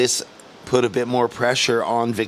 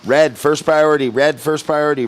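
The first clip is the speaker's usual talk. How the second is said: calling out; 5 semitones higher, 5 dB louder than usual. Real, not acted. Calling priority to surfers.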